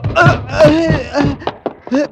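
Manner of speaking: high, strained grunts of pain; pained grunts from a fall. Acted. Fleeing man grunting in pain after missing the jump. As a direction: gasp out as if you just hit the roof. Narrator falls from roof wall.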